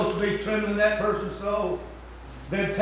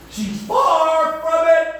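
slow, high, loud preaching cry; 1 preaching shout; 11 semitones higher, 8 dB louder, much slower than usual. Real, not acted. Preacher condemning a worldly singer, slow loud shout. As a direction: shout it down slowly from the pulpit.

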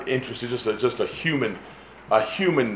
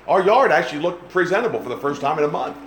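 usual speaking voice

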